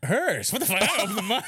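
high and loud through laughter; admitting he opened his own window, laughing hard. Real, not acted. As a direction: crack up while telling it.

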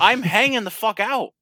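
loud, high exasperated yell; retold snappy outburst. Telling friend's relative off, snappy shouted comeback. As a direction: re-enact blowing up in protest. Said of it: "you don't have to get snappy".

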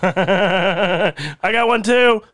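very slow, laughing boast; laughing joke. Retold or imitated. Driver jokes to the cops about his taser, laughing. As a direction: chuckle it out. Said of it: "joking to cops, laughing".

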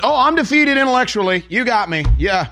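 high mock-defeated voice, dripping sarcasm; sarcastic surrender. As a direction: play the beaten man with heavy irony.

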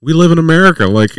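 high, loud retold protest; indignant retort. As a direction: throw it back at them, incredulous and loud.

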